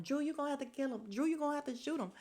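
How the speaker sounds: high, fast mock holler, no real volume. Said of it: "I'm hollering".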